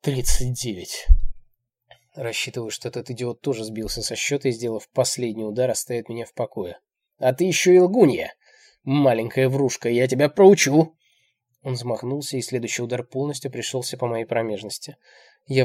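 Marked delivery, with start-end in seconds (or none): 0.0-1.1 slow, loud, controlled answer; effortfully calm. A narrator's reading. Narrator answers through tears, trying for calm. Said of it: "как можно более спокойным голосом ответить". 7.2-11.0 angry accusation. A narrator's reading. Old man calls her a liar, angry.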